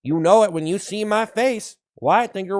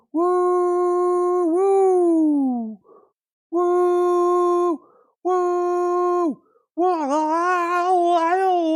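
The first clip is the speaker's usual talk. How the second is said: excited cheering; 12 semitones higher, much slower than usual. Acted. String of hype cheers.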